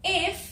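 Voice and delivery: "if" said short like the English word; "if" as a clipped English word; wrong if. Shown as a demonstration. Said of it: "बिल्कुल गलत है".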